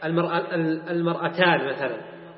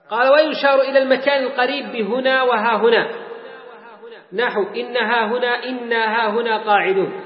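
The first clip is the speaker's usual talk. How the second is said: raised recitation of a textbook; reading text aloud; 5 semitones higher, 4 dB louder than usual. Acted. Teacher quoting the author on near places, recitation. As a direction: read it out formally, pitched up.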